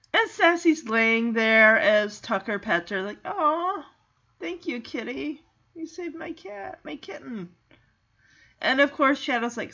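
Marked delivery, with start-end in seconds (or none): 3.3-7.5 soft, high cooing to a pet; tender thanks. Retold or imitated. Boy pets the cat who saved his kitten, tender cooing. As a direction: go very soft and sweet, as if petting.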